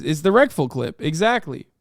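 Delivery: normal talking voice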